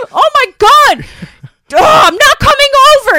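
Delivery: high, loud whiny near-crying wail. Retold or imitated. Lloyd wailing at his friend over the broken scarecrow, loud and whiny. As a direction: wail it like a hurt little kid. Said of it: "he's like".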